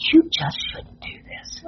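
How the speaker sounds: hushed scolding whisper; whispered teacher's scolding. Retold or imitated. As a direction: whisper it like a teacher chiding a child.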